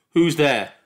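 sharp narrated cry into the dark. A narrator's reading. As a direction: shout it at someone unseen. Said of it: "he cried".

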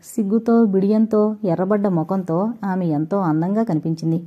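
usual speaking voice

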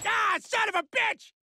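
high, drawn-out cursing yell; pained scream. Acted. Shot during a struggle, pained yell. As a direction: howl the curse in pain.

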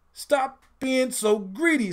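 high, slow indignant telling-off; outraged kid brother; indignant scold. A narrator's reading. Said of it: "said the youngest".

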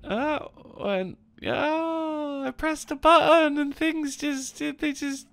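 high, drawn-out whine of despair; tearful wailing. Real, not acted. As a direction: wail the words out slowly, nearly crying. Distraught after pressing wrong button.

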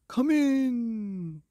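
high, loud bellowing king; booming king. Acted. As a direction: boom it out as a jolly king. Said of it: "cried the king with a yum".

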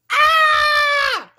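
high, held-out scream; long scream. Acted. Fed up with the alarm, long high scream. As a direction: hold one high frustrated scream.

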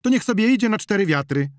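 high, loud heated exclamation; woman crying out in a quarrel; agitated cry. A narrator's reading. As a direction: shout it out, worked up. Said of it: "zawołała Zonia w podnieceniu".

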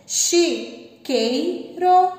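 "Chiqueiro" drawn out slowly, loud, syllable by syllable; teacher spells out "Chiqueiro" slowly. Shown as a demonstration. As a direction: stretch "Chiqueiro" so pupils hear "quei". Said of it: "Olha o quei".

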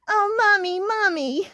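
high, plaintive kitten cry; put-on high wailing; kitten's wail. A narrator's reading. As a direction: wail like a tiny upset kitten. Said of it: "wailed little kitten".

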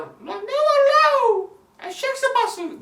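high, very loud scolding grandma voice; yelling grandmother. Retold or imitated. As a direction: holler back crossly, dragging the first words out. Acted grandmother yelling back at child. (bağıran babaanne taklidi)

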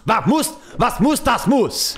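sing-song German, pitched high; half-sing it in a hammed-up German accent; mock German. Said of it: "I'm getting good at it".

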